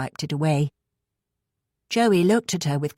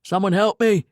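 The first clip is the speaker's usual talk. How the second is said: yelling; 4 semitones higher, 3 dB louder than usual. A narrator's reading. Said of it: "he yelled".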